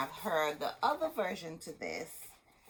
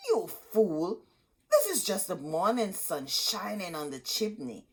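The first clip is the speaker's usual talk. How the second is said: a sharp, louder stepmother rebuke; scolding stepmother; 2 semitones higher, 6 dB louder than usual. Acted. Stepmother scolding Hansel on the forest walk. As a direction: scold the boy as a fool. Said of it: "the stepmother scolded".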